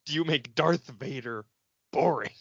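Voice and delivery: normal talking voice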